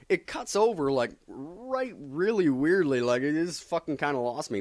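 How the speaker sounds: normal talking voice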